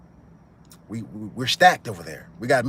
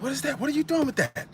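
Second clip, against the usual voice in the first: put-on much higher, startled young voice; surprised kid; 13 semitones higher, 4 dB quieter than usual. A cousin asking Carlito, high startled question. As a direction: play the nervous young cousin. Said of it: "He said".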